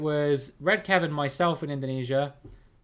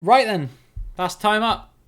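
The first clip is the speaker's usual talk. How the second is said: calling out; 7 semitones higher, 6 dB louder than usual. Real, not acted. Calling time on the round.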